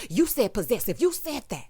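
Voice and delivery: mock finger-pointing accusation, pitched high; imitated accusing mom. Commentator mimicking the mom confronting him, mock accusing. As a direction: jab it out, imitating an insistent mother. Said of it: "you started pointing your finger, leaning all in".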